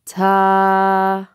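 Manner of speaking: "Ta" held long and loud on a level ah; long ah sound. Shown as a demonstration. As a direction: stretch the ah in "Ta", steady and loud. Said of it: "What makes the ah sound?".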